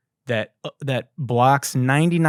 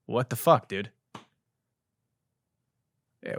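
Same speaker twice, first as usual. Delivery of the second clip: normal talking voice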